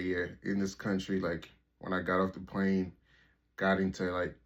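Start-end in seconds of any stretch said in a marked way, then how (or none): none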